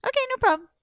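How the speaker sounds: high, loud, chipper acceptance; breezy eagerness. Acted. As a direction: agree brightly, voice jumping up. Anansi cheerily accepts impossible task.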